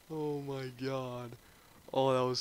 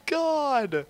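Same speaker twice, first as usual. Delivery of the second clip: ecstatic cry; 13 semitones higher, 9 dB louder, much slower than usual. Real, not acted.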